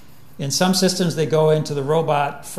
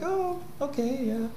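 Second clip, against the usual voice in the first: high, soft, relaxed cow; accepting and at ease; calm cow; 10 semitones higher, 9 dB quieter than usual. Retold or imitated. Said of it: "it's like".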